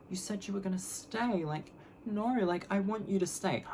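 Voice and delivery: put-on high, babyish wheedle; put-on pleading. Begging her date to stay, put-on pleading. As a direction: plead sweetly, high and a little soft. Acting insincere plea to date.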